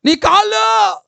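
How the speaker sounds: high, slow shouted cry; mock shout. Preacher acts out a beaten person shouting, high mock shout. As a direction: cry out as if struck again. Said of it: "నేను ఎంత గట్టిగా అంటే".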